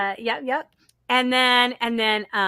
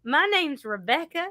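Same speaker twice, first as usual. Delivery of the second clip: put-on South Carolina accent; 4 semitones higher than usual. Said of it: "I had the accent".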